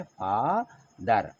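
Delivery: फादर drawn out very slowly; slow model pronunciation. Shown as a demonstration. Teacher drawing out "फादर" for the class. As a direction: stretch "फादर" slowly so pupils can copy. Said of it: "क्या लिखा हुआ है?".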